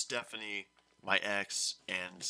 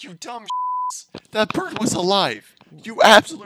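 much higher, louder yell through laughter; laughing mock rage; 11 semitones higher, 18 dB louder than usual. Narrator yelling at bird-burying classmates, laughing rage. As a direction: blow up at them, cracking up. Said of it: "this was my reaction at least".

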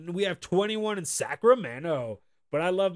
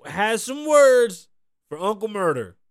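ordinary talk, nothing marked

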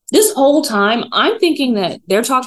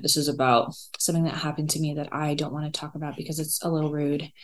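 hushed aside; 9 dB quieter than usual. Real, not acted. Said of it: "aside she won't discuss".

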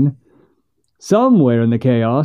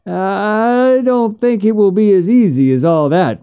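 higher, hesitant voice of an uneasy man; tongue-tied hedge; 6 semitones higher than usual. Acted. Hank hesitantly tells Missus Finch, pitched higher. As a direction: hem and haw as you break bad news. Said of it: "hemmed and hawed".

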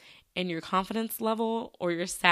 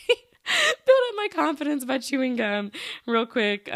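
laughing; 6 semitones higher, 5 dB louder, slower than usual. Real, not acted. Laughing at the idea.

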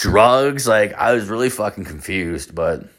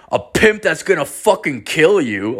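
raised-pitch mock threat; imitated menacing comeback; threatening pimp; 6 semitones higher than usual. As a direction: play the angry pimp, emphatic and higher. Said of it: "And he's like".

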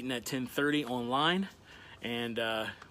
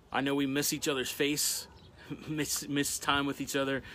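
normal talking voice

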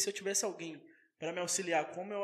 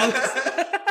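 laughing; 7 semitones higher, 9 dB louder, much slower than usual. Real, not acted. Laughing at drink order joke.

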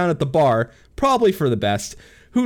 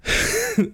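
high, breathless sigh; 3 dB quieter than usual. Real, not acted. Loud exhale, out of breath.

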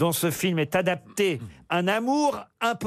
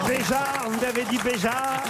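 mock-puzzled theatrical repeat, pitched up; parody film quote; 6 semitones higher than usual. Host echoes a colleague's pun as a film quote, mock-theatrical.

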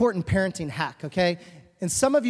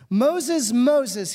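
calling out; 5 semitones higher, 6 dB louder than usual. Retold or imitated. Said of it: "God called out".

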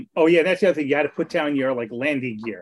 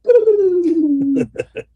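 disintegrating noise; 13 semitones higher, 5 dB louder than usual. Retold or imitated. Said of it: "disintegrate and go".

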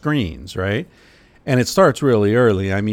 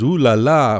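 mock French; 3 semitones higher, 6 dB louder than usual. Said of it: "who says".

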